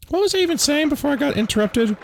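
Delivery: high, quick and indignant; squeaky put-on voice. Jokey aside in a put-on voice.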